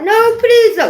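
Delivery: high, loud mock plea; pleading. Imitating Bill Gates crying in a vision, loud pleading. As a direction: beg for mercy.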